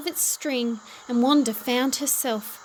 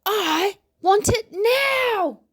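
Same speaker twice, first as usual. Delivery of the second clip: slow, loud tantrum rising much higher on the last words; screaming; 8 semitones higher, 7 dB louder, slower than usual. A narrator's reading. Wanda screaming at the shopkeeper for the kite, loud tantrum. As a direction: scream it out like a furious child stamping her feet. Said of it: "she screamed".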